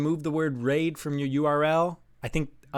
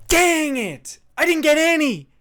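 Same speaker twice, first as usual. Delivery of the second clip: loud, high frustrated yell; frustrated shout; 9 semitones higher, 5 dB louder than usual. Real, not acted. Streamer yelling at missing every point, frustrated. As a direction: blurt out the frustration. Missed a trivia answer.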